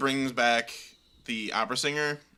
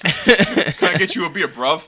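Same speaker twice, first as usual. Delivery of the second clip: laughing, higher and louder British barman; mock British accent; 6 semitones higher, 9 dB louder than usual. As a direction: ham up a London pub accent through a laugh. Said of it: "a beard and a British accent".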